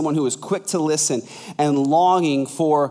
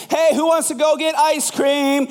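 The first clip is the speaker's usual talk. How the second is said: calling up the stairs; 11 semitones higher than usual. Pretend. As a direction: sing it up the stairs like a treat is coming.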